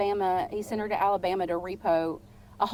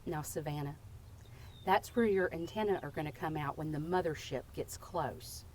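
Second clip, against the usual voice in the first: deadpan mom; 5 semitones lower, 8 dB quieter than usual. Retold or imitated. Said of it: "she just took a moment and looked at me and said".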